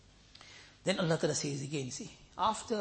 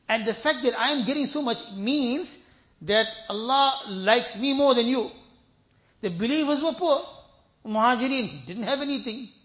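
louder, higher preaching at full force; forceful preaching; 4 semitones higher, 7 dB louder than usual. Acted. Preacher condemning the arrogant rich, forceful. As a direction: drive it home to the congregation.

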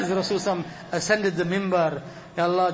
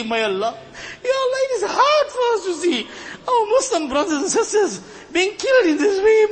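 high, loud pleading that breaks into sobs; desperate tearful plea for the suffering, pitched high; weeping prayer; 9 semitones higher, 4 dB louder than usual. Real, not acted. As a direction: cry out to God through real tears. Impassioned dua for suffering Muslims.

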